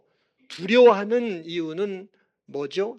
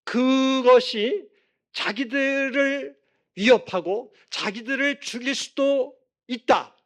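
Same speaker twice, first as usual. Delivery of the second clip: slow, drawn-out, higher-pitched emphasis; emphatic preaching; 6 semitones higher, 4 dB louder than usual. Real, not acted. Preacher explaining the disciples' terror, emphatic preaching. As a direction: stretch each phrase out weightily, pitched up. Preacher drives point home.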